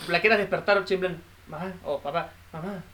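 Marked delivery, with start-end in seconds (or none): 1.5-2.8 hushed, low call to a sleeping parent; barely raised, tentative murmur; soft waking call. Acted. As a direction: call softly, as if waking someone.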